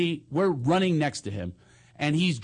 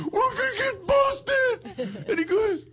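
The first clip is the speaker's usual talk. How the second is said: high, slow sobbing whine; mock crying wail. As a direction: wail like a goody-goody about to cry.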